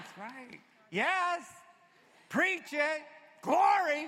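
high, loud exultant church calls; call and response. Acted. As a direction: whip up the congregation.

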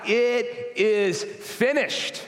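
high, very slow and drawn out; crying out. Retold or imitated. Preacher voicing Jesus on the cross, high slow cry. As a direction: cry it out slowly, like a final triumph. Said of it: "he yells out".

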